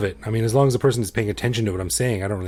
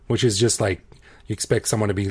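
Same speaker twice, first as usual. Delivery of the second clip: ordinary talk, nothing marked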